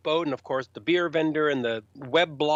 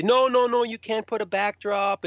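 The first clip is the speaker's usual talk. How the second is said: objecting official; 6 semitones higher than usual. Retold or imitated. Stage staff forbid the backdrop, high and insistent. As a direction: imitate fussy staff forbidding something.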